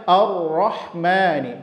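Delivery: "ar-Rahmani" with the first a thickened into o; salah baca, a tebal. Shown as a demonstration. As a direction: round the opening of "ar-Rahmani" to sound like "or". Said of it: "Kesalahannya nanti dibaca". ("ar-Rahmani" dengan a tebal jadi o)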